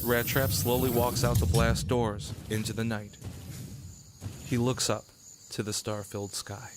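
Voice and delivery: normal talking voice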